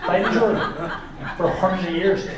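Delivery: a lightly voiced, meandering Irish comic, laughing; Jimeoin impression. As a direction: ramble like a confused Irish comic. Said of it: "Jamoan could run Ireland, you know, and be like".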